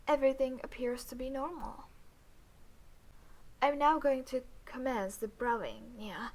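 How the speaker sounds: usual speaking voice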